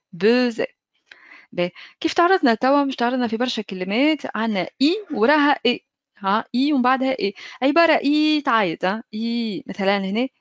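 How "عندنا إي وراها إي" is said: ordinary talk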